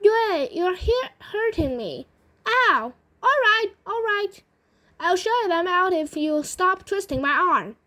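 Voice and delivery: high-pitched acted yelps of complaint; storybook grandma crying ow; pained protest. A narrator's reading. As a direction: protest as if your arm is being twisted. Grandma's arm is twisted.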